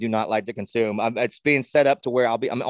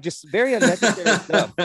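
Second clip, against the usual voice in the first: ordinary talk, nothing marked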